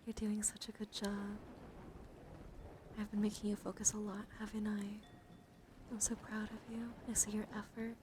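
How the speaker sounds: normal talking voice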